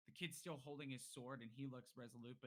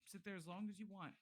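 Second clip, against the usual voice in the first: high-pitched, careful appeasing voice; nervous placating; 6 semitones higher than usual. Acted. Villagers edging towards the door, nervously placating. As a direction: appease them in a higher voice.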